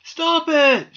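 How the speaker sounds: high, loud command to stop; shout. A narrator's reading. Doll to squirrel leaping about, urgent shout. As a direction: shout it up to the roof. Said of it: "shouted Marianne".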